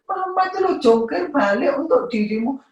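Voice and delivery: high, dramatic mock grievance; milk the sacrifice for sympathy; mother's hammed-up lament to her child; complaining mother. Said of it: "Jangan mengeluh".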